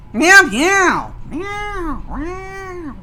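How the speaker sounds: high-pitched pretend cat meows; high, drawn-out kitty impression; cat meowing. Imitating a cat after calling kitty.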